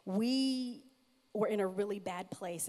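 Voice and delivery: ordinary delivery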